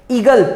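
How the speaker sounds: ईगल slow with a long ee at the start. Shown as a demonstration. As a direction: stretch the ee of ईगल. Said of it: "ईगल में ई sound आ रहा है".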